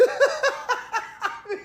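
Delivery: high, rapid cackle of laughter; mocking laugh. Real, not acted. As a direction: burst out in a long, high laugh. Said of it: "get through this post without laughing".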